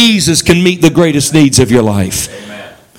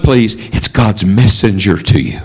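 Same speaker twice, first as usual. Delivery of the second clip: normal talking voice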